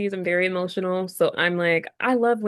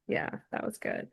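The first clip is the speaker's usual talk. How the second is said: whispered satisfied sigh; contented whisper; 4 semitones lower, 13 dB quieter than usual. Retold or imitated. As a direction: breathe it out, fulfilled. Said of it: "You're like".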